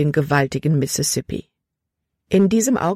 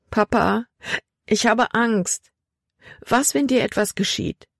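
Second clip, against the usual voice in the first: tearful; 6 semitones higher than usual. A narrator's reading. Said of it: "Tränen stiegen Libby in die Augen".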